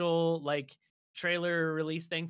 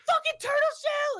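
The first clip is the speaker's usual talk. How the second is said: furious yelling; 6 dB louder than usual. Retold or imitated. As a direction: scream at the other racers like a psycho. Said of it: "she's just so angry".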